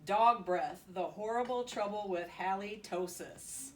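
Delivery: usual speaking voice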